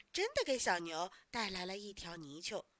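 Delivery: ordinary delivery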